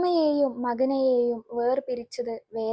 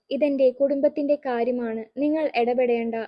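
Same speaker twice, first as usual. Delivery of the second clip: plain reading voice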